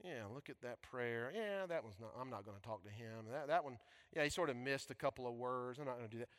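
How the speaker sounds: soft, dismissive critic murmuring; lightly voiced fussy speech judge; a hint of a bored judge; mock picky judge. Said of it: "God's not a speech judge saying".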